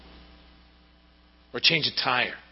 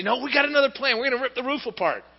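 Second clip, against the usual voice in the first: high, quick excited plan; lightly voiced eager friends; 12 semitones higher, faster than usual. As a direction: rush it out with glee. Friends announce new plan.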